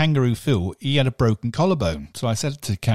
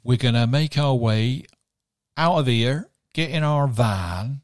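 slow West Country drawl; West Country accent; slower than usual. Pretend. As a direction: put on a playful West Country burr, slowly. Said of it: "talking on a West Country accent now".

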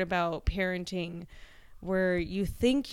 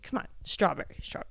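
hushed, whispered prompting; coaxing whisper; 10 dB quieter, much faster than usual. Retold or imitated. Mother prompting her child to say strawberry in Italian, coaxing whisper.

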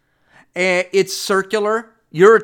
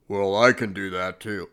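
lowered voice demo; 9 semitones lower, 4 dB quieter than usual. Shown as a demonstration. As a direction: drop the voice much lower to show it's easy. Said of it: "she goes in and lowers her voice".

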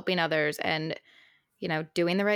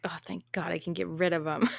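mock secret whisper; whispered aside; 3 semitones lower, 6 dB quieter than usual. Co-host jokes about the shoe girl in a whisper. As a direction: whisper it like a confession.

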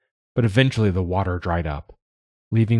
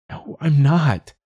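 high, drawn-out tearful protest; distressed denial; 7 semitones higher, 4 dB louder, slower than usual. A narrator's reading. Child rejecting dad's praise, tears surging, distressed denial. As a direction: protest slowly, pitched up in misery.

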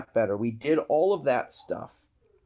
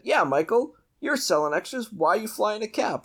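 high, teasing parrot of the line; Walter mocking; 10 semitones higher than usual. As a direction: mimic him, pitched up and mocking. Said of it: "Walter looked at me and he said".

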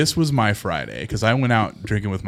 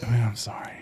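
sheepish whispered sorry; whispered apology; 6 dB quieter than usual. Real, not acted. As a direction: breathe it out as a whisper.

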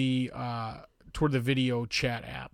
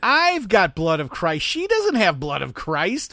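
mock outrage; 10 semitones higher, 7 dB louder than usual. Mock confusion.

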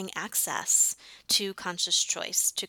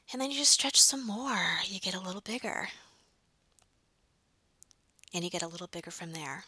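guided meditation. Acted.

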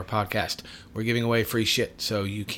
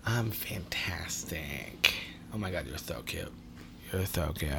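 slow tender whisper; 4 semitones lower, 8 dB quieter than usual. Real, not acted. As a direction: whisper it softly and slowly. Whispering to wife.

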